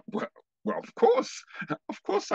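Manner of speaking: high, giggly, flustered agreement; flustered laughing yes. Retold or imitated. Caught off guard by her request, flustered giggly yes. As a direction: gush it out with a nervous laugh.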